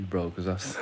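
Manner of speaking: ordinary talk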